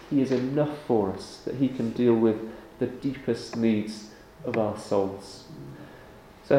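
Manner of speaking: hushed, reverent prayer voice. Acted. Pastor closing prayer in a low reverent voice. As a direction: pray softly to the congregation.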